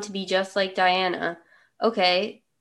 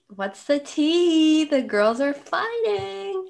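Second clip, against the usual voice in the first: high, slow, sing-song gossip voice; TikTok quote; 9 semitones higher, slower than usual. Retold or imitated. Quoting a TikTok about a fight in gossipy sing-song. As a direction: drawl it high like the TikTok sound. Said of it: "that TikTok that's like".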